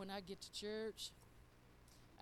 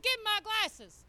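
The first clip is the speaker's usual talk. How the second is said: louder, higher, snapping it back; loud demand; 9 semitones higher, 13 dB louder than usual. Retold or imitated. Wife grabbing her glasses from husband, loud and demanding. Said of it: "I said".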